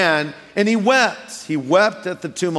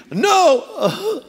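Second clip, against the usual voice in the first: mock girl crying; 12 semitones higher than usual. Girl refusing a guy, high teary no. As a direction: cry out the no. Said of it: "if you g".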